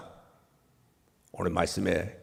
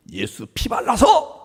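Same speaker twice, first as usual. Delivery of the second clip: preaching shout; 12 semitones higher, slower than usual. Acted. Preaching climax on Jesus' blood.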